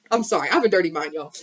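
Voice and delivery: usual speaking voice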